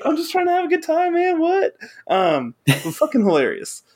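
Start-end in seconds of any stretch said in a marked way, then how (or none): 0.0-1.8 very high, cartoonish chill-out plea; mock squeaky brush-off.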